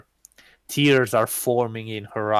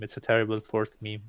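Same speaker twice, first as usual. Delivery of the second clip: normal talking voice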